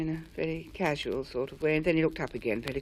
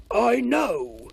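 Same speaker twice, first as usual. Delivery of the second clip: proudly; 5 semitones higher, 6 dB louder than usual. A narrator's reading. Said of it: "said the squamp proudly".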